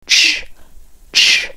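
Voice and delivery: "ch" as a voiceless burst with pouted lips; isolated ch sound. Shown as a demonstration. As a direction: push lips forward for a bare "ch". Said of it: "嘴巴是往前撅的". (撅嘴单独发两次ch)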